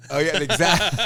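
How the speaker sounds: laughing agreement, then laughter. Real, not acted. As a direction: agree while cracking up. Laughs at [name] remark.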